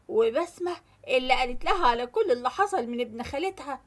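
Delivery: ordinary delivery, nothing marked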